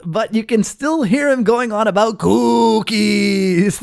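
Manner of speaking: usual speaking voice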